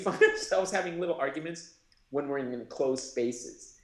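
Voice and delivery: ordinary delivery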